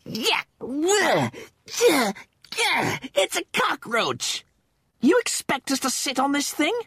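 high, disgusted heaving; gag, then force out the protest; retching between words. Acted. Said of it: "Rimmer said between heaves".

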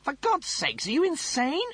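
high, exasperated snap; snapping Rimmer. Acted. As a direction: snap at him in disbelief. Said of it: "Rimmer snapped".

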